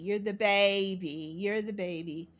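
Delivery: usual speaking voice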